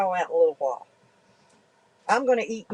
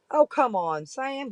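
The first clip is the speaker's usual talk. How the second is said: ordinary delivery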